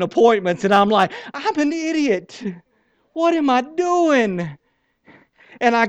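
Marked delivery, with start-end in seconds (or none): 1.3-4.5 high, slow, whining at himself; a preacher mocks his own past panic to the congregation, in a high slow whine; self-mocking whine. High slow self-mocking whine.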